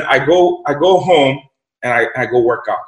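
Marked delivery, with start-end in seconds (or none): none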